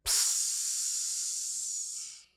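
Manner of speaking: a soft, breathy sizzle like skin on a hot heater; just hiss the burn sound; imitated sizzle hiss. Baby touches hot heater.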